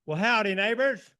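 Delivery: big, high, very loud howdy to the crowd; hollered greeting. Real, not acted. Speaker greets a county crowd, hollered. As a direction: holler hello to a whole hall.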